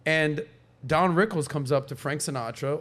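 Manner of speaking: ordinary talk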